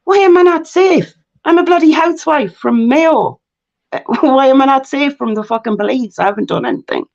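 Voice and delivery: high-pitched, incredulous retold outburst; indignant disbelief. Housewife told she's safe enough, indignant inner protest.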